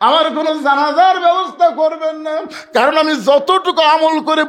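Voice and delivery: high, loud preacher's cry; impassioned outcry. Retold or imitated. Fatema forbidding any funeral prayer to Ali, impassioned outcry. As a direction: cry the request out at high pitch. Same request repeated as an outcry.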